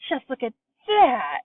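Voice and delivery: high, loud, wide-eyed wonder; boy shouting at a miracle; amazed shouting. A narrator's reading. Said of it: "he was shouting".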